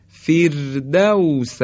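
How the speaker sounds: فردوس slowly, with a thin R; light R. Shown as a demonstration. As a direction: recite فردوس drawn out, R light. (فردوس کشیده با «ر» نازک)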